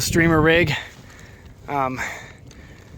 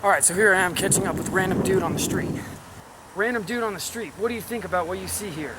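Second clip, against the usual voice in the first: street reporter; 5 semitones higher, 6 dB quieter than usual. Pretend. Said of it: "go live to our reporter".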